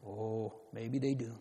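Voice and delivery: low, soft sigh of an aside; hushed concession. Real, not acted. Preacher granting that the children may play, hushed. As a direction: drop right down, gently granting it.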